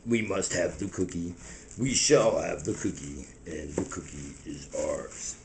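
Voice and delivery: very low, hushed incantation; solemn cookie mystic. Acted. Cookie Monster declaring we must have the cookie, solemn and hushed. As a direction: intone it like a sacred creed.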